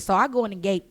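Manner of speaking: ordinary talk, nothing marked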